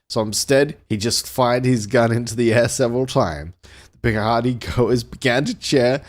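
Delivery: dramatic pulp-thriller read-aloud; deliberate storyteller delivery; story narration. A narrator's reading. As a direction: narrate an action novel with relish.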